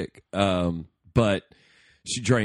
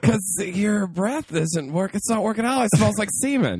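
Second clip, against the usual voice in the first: mock girlfriend voice; 9 semitones higher than usual. Friend voicing the girlfriend's reply, high silly mock. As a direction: drag it out high and mocking.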